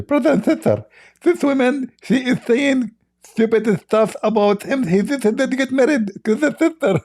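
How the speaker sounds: high mock voice of a man, hammed-up; mocking Ali Dawa. Mocking Ali Dawa's video to his audience, in a high hammed-up voice. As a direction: squeak it out like a parody of Ali Dawa. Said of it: "I cannot make your voice".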